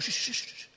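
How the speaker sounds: unvoiced shushing to cut him off. Friend hushing the doubting pastor with a shushing sound. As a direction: hush him with a string of shh's. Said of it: "he goes".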